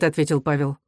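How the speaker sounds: plain reading voice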